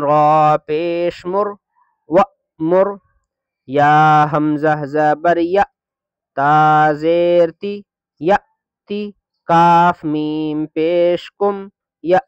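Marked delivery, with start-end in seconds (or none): none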